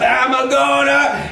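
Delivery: high, slow threat; winding-up Brer Rabbit. Acted. Rabbit winding up to butt, high drawn-out threat. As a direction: wind up the threat, stretching it out. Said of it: "he starts to wind his head up".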